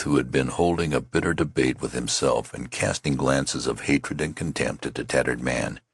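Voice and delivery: ordinary delivery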